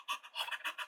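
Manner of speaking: sharp unvoiced gasp of fright; audience gasp. Vlogger mimicking a scared horror audience with a gasp. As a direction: gasp like a startled horror fan. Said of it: "get the audience being like".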